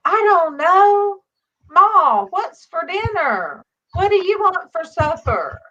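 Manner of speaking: high, loud and slow calling to the house. Retold or imitated. Calling out to Ma while cleaning the pool. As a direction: holler the question out slowly. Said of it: "I'm saying all this loud".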